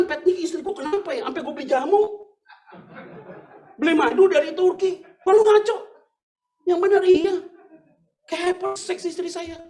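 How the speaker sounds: high, loud, exasperated complaining voice; baffled husband. Retold or imitated. Husband complaining to the pastor, high and exasperated. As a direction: play the bewildered husband pouring out his problem.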